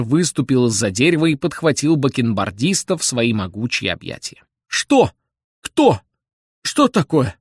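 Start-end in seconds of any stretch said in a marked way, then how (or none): 4.7-7.4 put-on high, startled old man's cries; agitated cry. A narrator's reading. Restless old man grabbed by Bender, high alarmed cries. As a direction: fire off startled questions in a high voice. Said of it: "закричал беспокойный старик".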